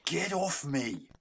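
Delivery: slow, high exasperated yell; frustrated yell. Real, not acted. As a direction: drag it out, fed up. Gamer yells at attacking enemy.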